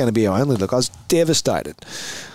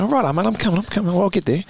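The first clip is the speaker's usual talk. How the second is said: higher-pitched placating reply; hurried reassurance; 8 semitones higher than usual. Retold or imitated. Player answering his yelling teammate, hurried and high.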